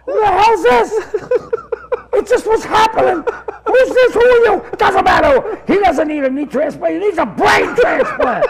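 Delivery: hammed-up, high, loud phone quarrel; imitated irate screeching argument; shouting match. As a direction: yell back and forth in mock outrage.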